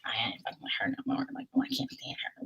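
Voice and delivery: fast, hushed whisper of her private grudge; voicing the friend's inner thoughts in a quick whisper. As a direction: whisper it quickly like a thought she'd never say aloud. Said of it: "like, in her head".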